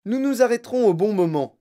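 loud, high, emphatic retort; Monsieur Wonka exclaiming. A narrator's reading. Wonka answering impatient Veruca, exclaiming. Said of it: "s'écria monsieur Wonka".

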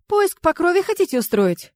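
high-pitched, keen, teasing offer; predatory smile. A narrator's reading. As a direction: ask with a hungry smile. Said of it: "хищно улыбнулась Сая".

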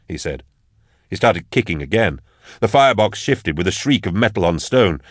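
ordinary delivery

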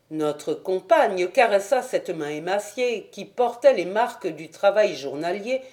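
ordinary delivery

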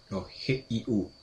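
letters dropped soft and slow; spelling slowly. Shown as a demonstration. Correcting his own spelling, soft and slow. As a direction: softly spell each letter, taking your time. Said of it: "Hughes es como si fuera".

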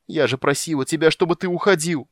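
high, loud wailing plea; anguished moan. A narrator's reading. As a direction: cry it out in despair. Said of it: "простонала Тика".

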